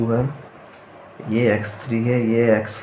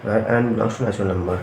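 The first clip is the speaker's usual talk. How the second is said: very slow, word-by-word dictation; 3 dB quieter, much slower than usual. Acted. Teacher dictating the problem statement to the class, very slowly. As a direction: dictate so students can write it down.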